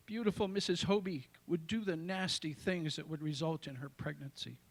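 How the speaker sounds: lively, list-like reading of a joyful poem; a proud reading at an open mic. Acted. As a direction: announce each joy, then tell it. Reads his poem Nine Joys.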